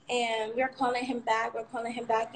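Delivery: usual speaking voice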